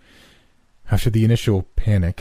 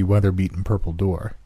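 ordinary delivery, nothing marked

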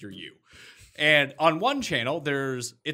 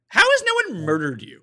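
appalled exasperation; 6 semitones higher, 7 dB louder than usual. Real, not acted. Appalled at co-host's gift idea.

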